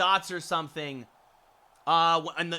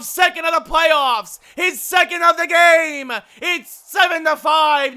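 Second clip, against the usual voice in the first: high, full-force shouted hockey call with stretched key words; roaring goal call; 11 semitones higher, 15 dB louder than usual. Acted. Commentator announcing the game-sealing goal to viewers, exultant high shouting. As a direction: erupt as the puck goes in.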